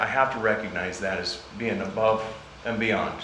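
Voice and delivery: ordinary talk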